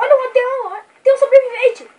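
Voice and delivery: loud, high excited call. Real, not acted. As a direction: call out the discovery eagerly. Spots survivor, excited.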